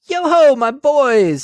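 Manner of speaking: loud, high, slow jolly call; a hearty old master calling his lads; fat jovial voice. Acted. Fezziwig calls his apprentices, jovial hearty call.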